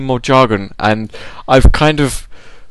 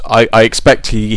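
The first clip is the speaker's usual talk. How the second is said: ordinary talk, nothing marked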